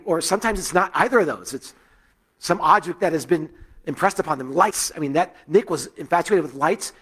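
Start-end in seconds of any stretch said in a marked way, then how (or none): none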